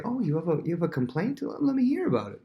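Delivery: high-pitched, rising show of interest; eager sympathy. Acted. Prince inviting a gripe, eager sympathy. Playing Absalom inviting a complaint.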